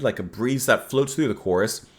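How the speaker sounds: usual speaking voice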